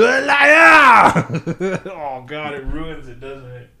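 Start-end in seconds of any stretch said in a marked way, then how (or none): 0.0-1.8 loud, slow, high mock French shout; French Anakin. Imagining French Anakin on Mustafar, loud hammed-up yell. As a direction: ham up Anakin's betrayed cry in French. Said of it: "French Anakin".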